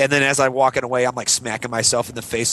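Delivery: ordinary delivery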